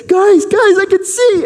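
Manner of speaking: high, loud calling to friends; excited call. Acted. Man running to his neighbors, calling out excited. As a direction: call out to them thrilled. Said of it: "he's like".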